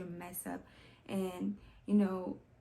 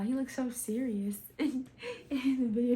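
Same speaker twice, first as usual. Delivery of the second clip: amused laughing; 5 semitones higher, 4 dB louder than usual. Real, not acted. Amused at artist's serious look.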